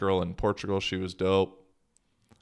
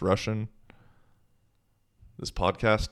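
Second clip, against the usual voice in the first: normal talking voice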